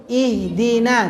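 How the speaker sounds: slow Ihdina with a drawn-out, spread-lip i; teacher demo, Ihdina said slowly; clear I demo. Shown as a demonstration. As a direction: model Ihdina slowly for the class to repeat.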